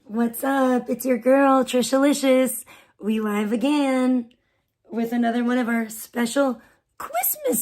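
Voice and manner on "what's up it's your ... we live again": normal talking voice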